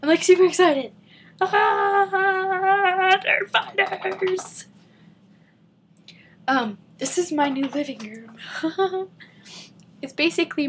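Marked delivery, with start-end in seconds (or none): 1.4-4.7 high, slow, drawn-out cry; fan squeal. Real, not acted. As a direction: stretch it into a long high squeal. Fannish squeal to audience.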